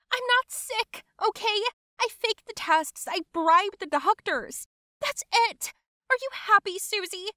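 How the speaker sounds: very high, heated outburst; high-pitched, angry admission; exasperated confession. Acted. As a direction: blurt it all out, high and fed up. Freaking-out cousin confesses after yelling.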